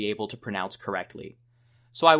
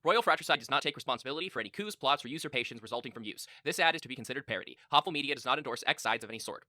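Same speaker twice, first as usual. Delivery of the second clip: rattled-off fine print, fast and softer; fast disclaimer; 3 semitones higher, 6 dB quieter, faster than usual. Acted. Fake ad closes with its fine print, rushed disclaimer. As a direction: race through the legal small print.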